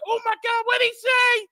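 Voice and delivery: high shrill mock gasp; mocking shocked believers. Mocking the offended Christians with a high shocked voice. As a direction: squeak it out like scandalized churchgoers. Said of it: "And they said".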